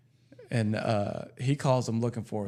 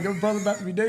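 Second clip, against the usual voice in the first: higher-pitched mock threat, laughing; getting madder; 7 semitones higher, faster than usual. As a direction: imitate an outraged husband, amused. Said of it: "getting more and more mad".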